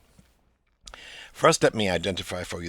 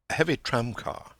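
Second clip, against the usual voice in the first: ordinary talk, nothing marked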